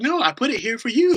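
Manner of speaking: put-on high, loud small-child protest; little daughter. Daughter insists to dad it was for him, little-girl protest. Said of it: "And she's like".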